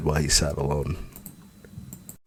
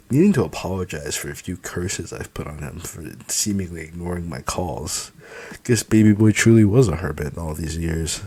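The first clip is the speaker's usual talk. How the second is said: ordinary delivery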